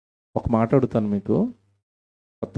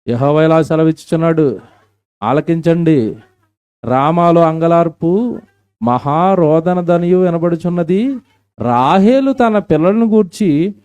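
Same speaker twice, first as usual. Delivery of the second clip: slow, loud and much higher; fervent scripture proclamation; 6 semitones higher, 8 dB louder, slower than usual. Acted. Preacher urging weeping girls, slow and loud. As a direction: stretch the verse out as a loud cry. Reads Jeremiah aloud.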